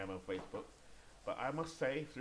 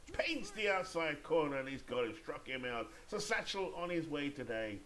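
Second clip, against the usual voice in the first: excited strikeout call, pitched up; play-by-play; 5 semitones higher than usual. Pretend. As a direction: call the strikeout live with a lift. Calls a strikeout.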